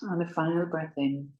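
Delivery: soft, slow, drawn-out breath counts; guided breathing meditation. Acted. Yoga teacher counting listeners' breaths in and out, hushed meditative guiding. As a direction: pace the breath gently, holding each count long and level.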